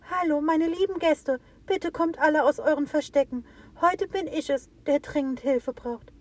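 high voice calling to the animals. A narrator's reading. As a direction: call out to hidden guests. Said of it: "So rief der kleine Tannenbaum".

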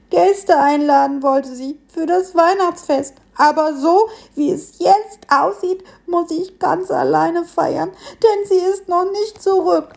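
high, slow, forlorn piglet voice; sad little piglet. A narrator's reading.

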